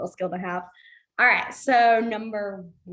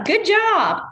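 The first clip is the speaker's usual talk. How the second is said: higher, louder, slow emphatic praise; hearty praise; 9 semitones higher, 9 dB louder than usual. Real, not acted. Teacher praising her second-week ASL class, drawn-out and loud. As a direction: beam it out to the class.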